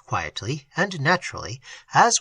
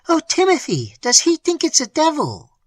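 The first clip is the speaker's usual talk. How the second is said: high, loud cry of alarm; frightened cry; 12 semitones higher, 5 dB louder than usual. A narrator's reading. Terrified wife cries to her husband about a devil, loud. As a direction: wail it out in fear to her husband. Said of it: "cried the boatswain's mate in a voice".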